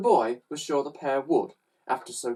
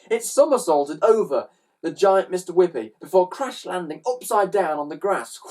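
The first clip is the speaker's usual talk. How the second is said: ordinary delivery, nothing marked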